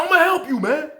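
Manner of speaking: high, loud offer of help; insisting. Retold or imitated. Officer insisting on helping the inmate, loud and high. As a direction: push the offer on him loudly. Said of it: "Jackson said".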